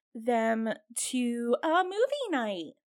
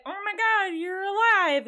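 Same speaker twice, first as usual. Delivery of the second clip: higher, louder, amazed relief; surprised; 7 semitones higher, 4 dB louder than usual. Retold or imitated. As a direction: gasp it out as if she's back from the dead. Retold shock at finding her.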